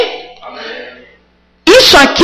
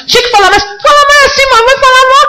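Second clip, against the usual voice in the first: much higher, sneering jeer; mocking Satan's taunt; 10 semitones higher, faster than usual. Preacher voicing Satan mocking how he speaks, high sneering jeer. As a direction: jeer like the accuser mocking poor speech. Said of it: "Satanás tá apontando".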